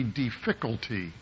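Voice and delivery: "difficulty" stressed on its second syllable, di-FIC-ulty; misplaced stress. Retold or imitated. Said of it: "the emphasis on the wrong syllable".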